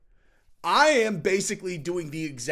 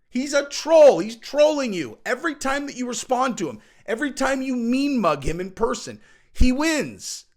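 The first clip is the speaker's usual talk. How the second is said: emphatic rant; 6 semitones higher, 5 dB louder than usual. Real, not acted. Ranting about trolling.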